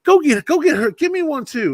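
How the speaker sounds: high, pushy aunt butting in; aunt chiming in for a soda, high and pushy; bossy auntie. Retold or imitated. Auntie voiced in a story.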